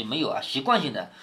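ordinary talk

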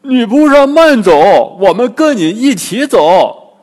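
high, very loud hail; loud call. A narrator's reading. Bajie calls after the departing girl, loud shout. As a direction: shout after someone, stretching the words out. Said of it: "大声对姑娘喊".

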